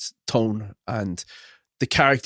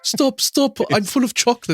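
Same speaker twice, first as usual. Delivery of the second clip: high-pitched pleading cartoon victim; mock pleading; 10 semitones higher than usual. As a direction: imitate a cartoon kid begging bullies to stop. Quoting bullied Simpsons exchange student.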